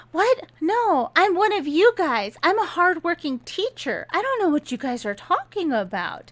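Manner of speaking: high, flustered denial; startled protest. Retold or imitated. Jacob protests accusation, voiced high.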